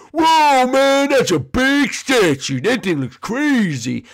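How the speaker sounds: loud, high and drawn out; wowed, stretching the words; high-pitched, slow amazement; amazed exclamation. Acted. Character amazed at giant statue.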